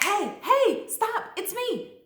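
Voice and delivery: high, loud panicked protest; acted alarmed plea. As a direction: cry out to halt an attacker. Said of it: "you be like".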